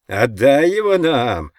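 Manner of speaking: loud, drawn-out jeering call; mocking shout. A narrator's reading. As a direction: yell it tauntingly from outside. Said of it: "прокричал снаружи другой, насмешливый голос".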